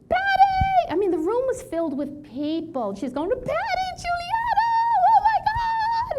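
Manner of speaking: very high, loud squealing welcome; joyful greeting. Retold or imitated. Hildy greets Patty in a crowded room, squealing with joy. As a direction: shriek her name like a long-lost friend.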